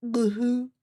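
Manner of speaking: deadpan creature voice; lightly voiced big pterodactyl; pterodactyl line. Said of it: "the pterodactyl turns and looks at the camera and goes".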